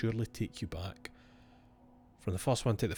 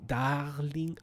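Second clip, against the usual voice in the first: higher French-accented darling, rising at the end; 5 semitones higher than usual. Shown as a demonstration. Tweaked darling for the letter, French accent. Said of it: "Had to, had to tweak it".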